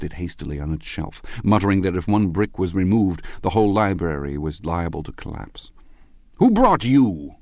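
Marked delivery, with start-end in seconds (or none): 6.3-7.4 high, loud, drawn-out question; slow, loud tipsy interrogation; drunken demand. A narrator's reading. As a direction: demand it slowly like a nosy drunk. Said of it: "he demanded".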